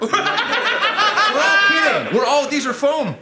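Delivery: high, loud mock panic; hammed-up alarmed yell; panicked larper. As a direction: plead like a role-player facing a real sword. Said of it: "the larping guy picked up a real sword".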